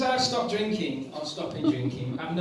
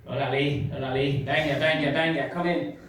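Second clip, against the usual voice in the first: put-on Spanish accent; 4 semitones lower than usual. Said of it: "he's like".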